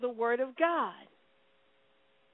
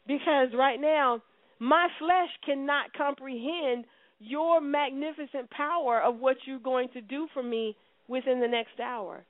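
normal talking voice

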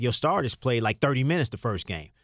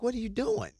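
high, jumpy retelling of a startled cry; startled question; 13 semitones higher, 4 dB quieter than usual. Retold or imitated. Kids spooked by the janitor, startled high question. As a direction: pitch it up like you just got spooked.

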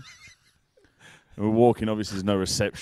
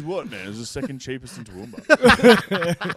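mate's defensive reply; 5 semitones higher, 8 dB louder than usual. Retold or imitated. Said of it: "And he goes".

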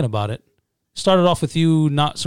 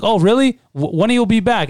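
high, loud feigned curiosity; put-on interested questioner, pitched up; mock nosy interest; 9 semitones higher, 4 dB louder than usual. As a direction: imitate someone fishing for information. Imitating a nosy would-be robber.

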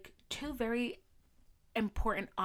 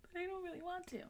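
much higher, soft, fast whine; whiny high voice; 11 semitones higher, 9 dB quieter, faster than usual. Retold or imitated. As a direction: whine it like a sulky kid. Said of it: "And I'm like".

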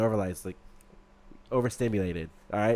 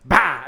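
a sharp, louder mock gunshot "bah"; gunshot noise; 7 dB louder than usual. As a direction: spit out a gunshot sound. Gunshot in the retold story.

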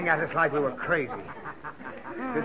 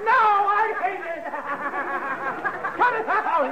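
being tickled; 9 semitones higher than usual. Acted. Still being tickled by child.

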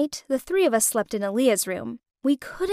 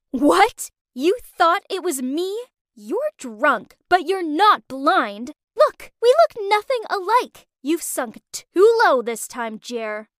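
angry disbelief; 4 semitones higher, slower than usual. Acted. Naomi confronting cheating Jeremy.

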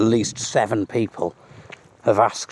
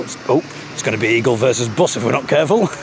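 ordinary talk

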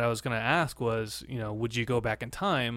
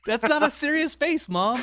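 exasperated protest; 4 dB louder than usual. Retold or imitated. Retelling his protest to his mom.

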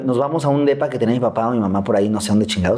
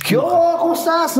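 much higher sing-song mom greeting; mom's forced cheer. Retold or imitated. Mother sent up to play with her son: high chirpy greeting. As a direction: greet the kid with put-on brightness. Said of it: "sube en plan".

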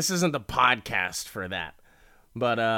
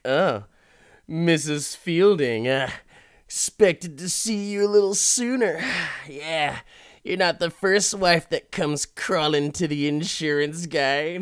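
smug seducer; 7 semitones higher, 6 dB louder than usual. Retold or imitated. Salesman greeting the wife at his boat, smug and high. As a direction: savour every word like a ladies' man.

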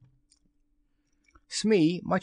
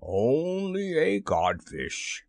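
slow, drawn-out scorn; lofty, lingering put-down; taunting; 3 semitones higher, much slower than usual. A narrator's reading. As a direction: stretch the insult out mockingly. The voice taunts the captain.

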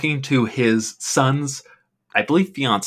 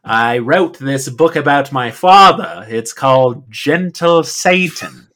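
louder, slow earnest boast; imitating the proprietor; 2 semitones higher, 7 dB louder, slower than usual. Podcaster mimicking the owner plugging his book, slow. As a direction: announce the book title with pride. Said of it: "the way he talks is".

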